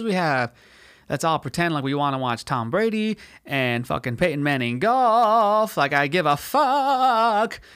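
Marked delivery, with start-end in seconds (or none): 4.8-7.6 high, loud, long-drawn words; sarcastic disdain. Real, not acted. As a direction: stretch it out in mock enthusiasm. Sarcastic rant about celebrity golf match.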